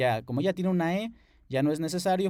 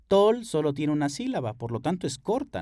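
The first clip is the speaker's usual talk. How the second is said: ordinary delivery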